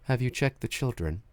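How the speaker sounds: low, soft, even menacing voice; quietly creepy phone voice; eerie calm caller. Retold or imitated. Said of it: "when you hear his voice".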